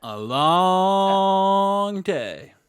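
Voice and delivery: very slow, loud, stretched echo; drawn-out echo. Retold or imitated. Cohost echoing the line, very slow and stretched. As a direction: drag it out even longer. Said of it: "echoing the line".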